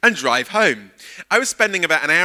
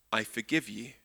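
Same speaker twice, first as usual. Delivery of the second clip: much softer, lower, sincere; gentle forgiveness; 6 semitones lower, 13 dB quieter than usual. Retold or imitated. Friend advising the speaker what to say, softly sincere. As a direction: say it tenderly, as a gift. Friend Steve's wise advice quoted.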